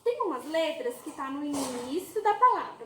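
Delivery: usual speaking voice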